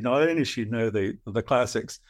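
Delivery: normal talking voice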